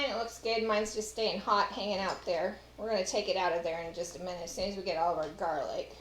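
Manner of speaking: usual speaking voice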